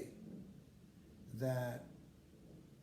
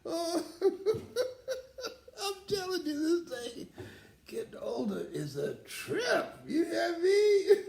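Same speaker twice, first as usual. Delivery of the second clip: much higher, slow, laughing through tears; laughing tearfully; 4 dB louder, slower than usual. Real, not acted. Speaker tells his audience that ageing is a trip, laughing through tears. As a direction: laugh it out, close to crying. Laughing about getting older.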